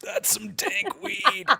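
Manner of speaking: dreamy stoned drawl; stoner voice. Retold or imitated. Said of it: "was like".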